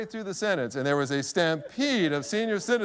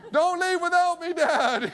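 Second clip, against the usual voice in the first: high, comic imitation of a boy calling; 11 semitones higher, 4 dB louder, slower than usual. Son wheeled away to Dad, high comic plea. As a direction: call to Dad as you are wheeled away, pitched high.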